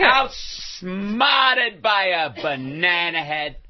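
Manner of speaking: very slow, drawn-out old actress impression; Ruth Gordon. Comedian performing on request for fans, Ruth Gordon impression. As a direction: stretch every word like a grand eccentric old lady. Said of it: "about to do his Ruth Gordon impression".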